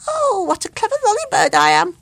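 high, loud, delighted little bird; chirpy boastful bird; enthusiastic bird voice. A narrator's reading. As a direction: crow with pride at your own cleverness. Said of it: "cried the Lollybird with great enthusiasm".

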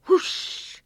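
loud rushing-air whoosh; sudden airy whoosh; whoosh sound effect. A narrator's reading. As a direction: imitate something flying past fast. Imitates bird flying out.

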